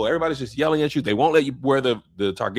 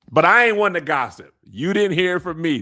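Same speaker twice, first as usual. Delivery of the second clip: light Miss Bonita gossip voice, louder; 4 semitones higher, 6 dB louder than usual. Retold or imitated. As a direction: lean in like the sitcom gossip lady. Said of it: "Miss Bonita, yeah".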